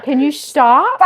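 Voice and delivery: high, loud exasperated plea. Retold or imitated. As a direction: beg him to stop, loudly. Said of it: "I'm like".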